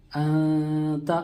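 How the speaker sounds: अंता very slow, n hidden in the nose; hidden nasal n. Shown as a demonstration. Teacher models the word for students, slow nasal. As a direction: glide अं into ता through the nose. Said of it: "इसी तरह इसको भी छुपाना है".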